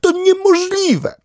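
very high, startled duck voice; a duck jumping up in disbelief; indignant duck. Acted. Duck to dog, startled disbelief in a very high voice.

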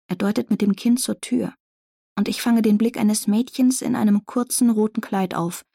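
ordinary delivery

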